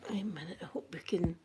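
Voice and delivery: whispered aside; soft unvoiced whisper to herself. Real, not acted. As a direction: breathe it under your voice. Quiet aside while setting up screen.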